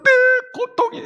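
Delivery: high-pitched acted sobbing; wailing lament. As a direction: pour out the pain in a tearful cry. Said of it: "통곡할 수밖에 없습니다". (울부짖으며 토로)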